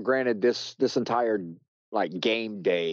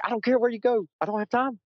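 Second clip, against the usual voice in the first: high, fast, frantic brush-off; rushing past the crew, brushing them off; faster than usual. Retold or imitated. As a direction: wave them off in a rush. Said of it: "I was like".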